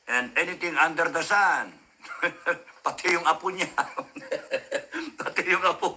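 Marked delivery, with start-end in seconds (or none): 3.7-5.1 a high, airy burst of laughter; high breathy laugh. Real, not acted. Laughs at own remark. 5.2-6.0 said through laughter; giggling the repeat; laughing. Real, not acted.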